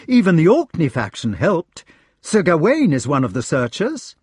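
high, lively report; eager knight. A narrator's reading. Searcher telling Lancelot who looked for him, eager. Eager news to found friend.

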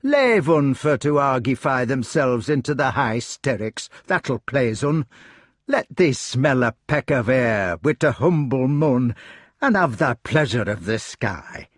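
broad dialect, gently coaxing; kindly rustic hedgehog. Acted. Hedgehog coaxing the broken-hearted king outdoors, kindly. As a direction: invite him out with homely dignity.

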